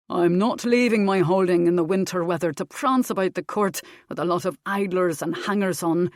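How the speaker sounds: grumbling husband. A narrator's reading. Husband complains to wife about court, gruff. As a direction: grouse about court life. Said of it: "Duncan Armstrong told his wife".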